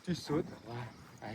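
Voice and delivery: normal talking voice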